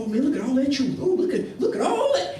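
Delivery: rising, awestruck gushing, acted out; mock amazement. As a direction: play someone dazzled, pitch climbing with each look. Mimicking people taken in by truth.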